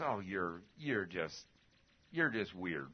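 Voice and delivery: a light imitation of his wife's fond tease; wife teasing. Wife tells husband he is weird, mildly imitated. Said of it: "And she says".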